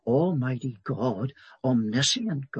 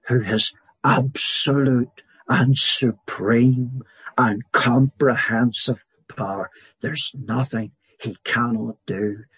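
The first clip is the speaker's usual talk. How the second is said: louder, rising preaching on God's power; full-force preaching; 5 dB louder than usual. Acted. Preacher exalting God's sovereignty to the congregation, louder and rising. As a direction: proclaim each title like a herald.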